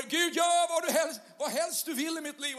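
mock fervent prayer; crying out to God. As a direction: cry it out to God with the whole heart. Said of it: "står här och så ropar".

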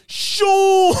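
very high, loud, long holler; imitated calling out. Woman with a golf club hailing Sean, imitated high shout.

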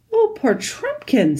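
high, slow sympathy; pitying. A narrator's reading. Lucy sees the dwarf's swollen cut, pitying. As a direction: draw it out with high-pitched pity.

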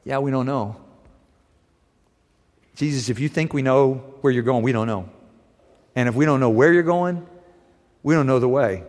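a hint of frank bewilderment; honest confused Thomas. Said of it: "And Thomas says to him".

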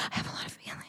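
very soft, quick, near-whispered admission; hushed confession. Real, not acted. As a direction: drop to a rushed whisper after screaming. Sheepish after apologizing for screaming.

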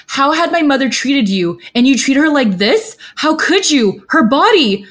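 high-pitched, anguished accusation; Xie Liang's tearful outcry. A narrator's reading. Xie Liang punching Qirong over his mother, anguished outcry. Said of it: "as he begins to cry".

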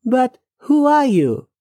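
high-pitched demand, falling at the end; insistent questioning. Acted. Man presses mysterious woman for her identity, insistent.

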